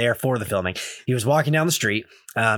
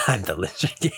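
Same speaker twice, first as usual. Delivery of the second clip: laughing; 4 semitones lower than usual. Real, not acted. Laughing while echoing the quote.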